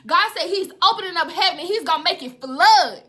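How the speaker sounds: loud, urgent prophetic preaching; full-force preaching. Real, not acted. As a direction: drive each command home to the congregation.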